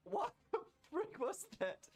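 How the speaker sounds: disbelieving squeal; high shocked squeak. Real, not acted. Gamer stunned by an attack on screen. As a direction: voice flies up in astonishment.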